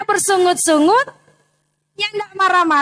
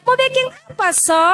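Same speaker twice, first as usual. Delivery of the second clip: put-on child asking; 8 semitones higher than usual. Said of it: "Pastikan mau tanya".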